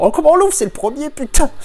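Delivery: high, loud frustrated cry; worked-up high-pitched exclamation; exasperated outburst. Real, not acted. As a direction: blurt it out loud, pitch shooting up. Excited shouting at unopenable box.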